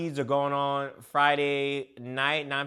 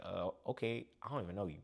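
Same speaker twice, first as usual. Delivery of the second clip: 1 hushed reply; 9 semitones lower, 15 dB quieter than usual. Retold or imitated. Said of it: "And I was just like".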